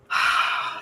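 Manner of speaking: real exasperated sigh; frustrated exhale; heavy sigh. Real, not acted. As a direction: let out a long unvoiced breath. Sighs after accidental losing trade.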